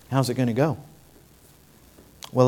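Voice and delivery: usual speaking voice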